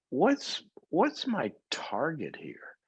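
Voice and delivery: hushed, slow, breathy question to self; whispered musing. Shown as a demonstration. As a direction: drop to a whisper and slow right down. Pondering aim, drops to whisper.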